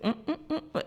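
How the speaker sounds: squeaky closed-mouth hums; high-pitched nervous humming. Retold or imitated. Worker stonewalling the cop with high squeaky hums. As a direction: hum high like you can't talk. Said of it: "And he's like".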